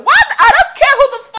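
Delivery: high, full-volume outraged yell; storming in. Retold or imitated. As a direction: burst in shouting, re-enacting the confrontation. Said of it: "And I stormed there".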